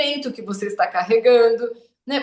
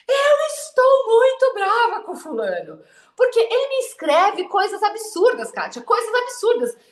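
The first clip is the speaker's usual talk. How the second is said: higher, indignant mock complaint; imitating an angry client; 9 semitones higher than usual. Therapist imitating a client furious at her ex, higher indignant voice. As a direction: play the furious ex-wife venting, pitched up.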